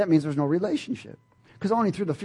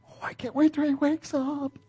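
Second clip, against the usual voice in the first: excited dad; 11 semitones higher than usual. Retold or imitated. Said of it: "He's excited".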